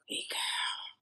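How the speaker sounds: soft, drawn-out whisper; whispered flirty greeting. Acted. Her eyes greeting the viewer through glasses, whispered. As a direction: whisper it as a sly hello. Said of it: "say something to you".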